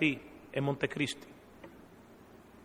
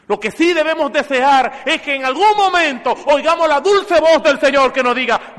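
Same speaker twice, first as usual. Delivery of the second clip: loud, high preaching at full force; fervent preaching; 9 semitones higher, 12 dB louder than usual. Acted. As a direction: drive the point home to the congregation. Preacher exhorts the congregation.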